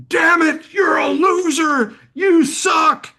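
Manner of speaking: high, loud mock yell at yourself; self-berating shout. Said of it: "You don't need to be, "Oh man".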